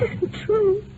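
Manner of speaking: high, breaking disbelief; the words crack up high in shock. Acted. Widow refusing the doctor's news, high and breaking.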